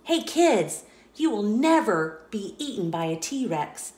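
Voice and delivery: ordinary delivery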